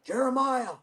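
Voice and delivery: retold high-pitched call across the shop; high-pitched imitated summons; mechanic calling out. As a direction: imitate someone calling you over by name. Mechanic calls out to teller.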